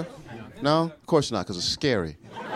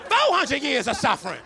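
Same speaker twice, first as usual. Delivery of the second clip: high, impassioned mock-civil-rights cry; mock righteous orator; 10 semitones higher than usual. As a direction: declaim it like a hammed-up freedom speech. Mock righteous speech to girlfriend.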